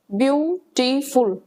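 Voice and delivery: Beautiful said slowly, split into its syllables. Shown as a demonstration. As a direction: break beautiful into separate beats to show it can't be said in one go. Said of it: "बीच में word में interruption आ रही है".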